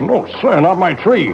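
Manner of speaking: stubborn refusal. Acted. Refusing to move the tree.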